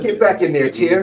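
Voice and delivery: cartoon cop ordering tear. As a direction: bark it at the tear like a tough guy. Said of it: "And he sniffs and he says".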